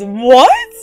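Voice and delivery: usual speaking voice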